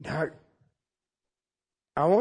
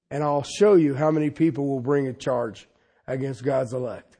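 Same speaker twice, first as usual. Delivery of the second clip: usual speaking voice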